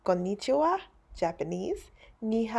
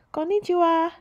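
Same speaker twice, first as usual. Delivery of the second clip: friendly greeting; 10 semitones higher, 5 dB louder than usual. Acted. Restaurant greeting.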